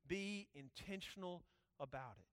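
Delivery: ordinary talk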